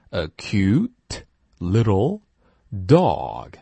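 very slow a cute little, rising on little; slow model phrase. Shown as a demonstration. Teacher reading a phrase slowly for repeating. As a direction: stretch each word for students to copy. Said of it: "Now repeat after me".

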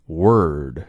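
Word with the tongue curled back for the r; Word said with a strong American r-colored vowel. Shown as a demonstration. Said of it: "卷舌。".

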